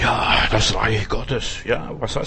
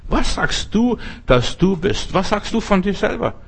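the same question thrown back at a much higher pitch, rising; pressing high question; 6 semitones higher than usual. Acted. Preacher presses the question on his hearers in a high, pressing voice. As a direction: asks Was sagst du again, far higher and insistent.

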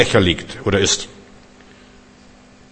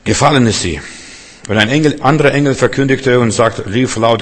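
normal talking voice

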